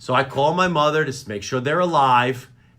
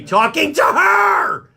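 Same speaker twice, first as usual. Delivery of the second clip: exasperated shout; 11 semitones higher, 5 dB louder, slower than usual. Acted. Son tells his mother off, exasperated shout. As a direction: build to a high yell.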